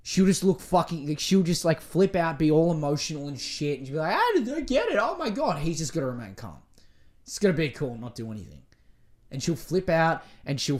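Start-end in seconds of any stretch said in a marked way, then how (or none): 4.2-5.6 high, whiny mock girlfriend; mock hysterical woman. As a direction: pitch it up like a flustered drama queen.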